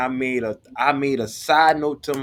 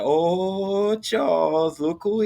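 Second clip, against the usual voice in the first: a lightly sing-song "Oh, Charles, look who", a bit higher; teasing classmates; 6 semitones higher, much slower than usual. Retold or imitated. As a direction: needle him like gossiping classmates. Class teasing as she walks in.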